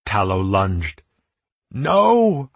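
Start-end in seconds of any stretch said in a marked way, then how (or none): 1.7-2.5 high, loud drawn-out cry; scream. A narrator's reading. Narrator sees Tallow lunge at Hayden, screaming. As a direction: scream it out in horror. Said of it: "I screamed".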